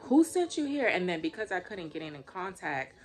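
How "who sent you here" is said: high, loud, sharp demand to strangers; angry demand. Retold or imitated. Homeowner confronting workers in her yard, loud angry demand. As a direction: confront them hard, voice up.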